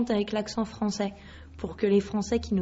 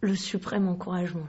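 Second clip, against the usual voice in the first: ordinary talk, nothing marked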